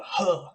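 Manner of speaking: a quick huh as if leaping up; jumping grunt. Shown as a demonstration. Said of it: "like, a jump sound".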